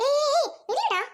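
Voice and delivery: calling out. Acted. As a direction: shout after him as he walks off.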